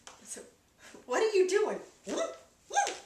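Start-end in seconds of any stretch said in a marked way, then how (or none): 1.1-1.8 loud, high mock bafflement; acted incredulous question; baffled question. Retold startled question to wife. 2.1-3.0 high-pitched goofy whoops; squeaky mock whoops; imitated whoop. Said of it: "she pulled one of these".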